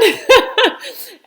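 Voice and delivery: high, loud laugh close to tears; emotional laughter on the verge of tears; teary laugh. Real, not acted. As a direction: laugh out the relief. Relieved laughter after story.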